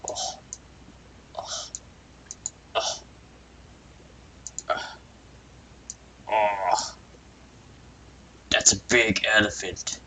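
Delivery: hushed, amazed little oh's; breathy awed whisper. Acted. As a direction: gasp softly at something huge. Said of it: "Philip, our friend here, was like".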